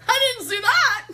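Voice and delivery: very high, loud squeal of disbelief; loud amazed exclamation. Retold or imitated. Reacting to street deliverance, squealed in disbelief. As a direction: squeal it in hammed-up astonishment.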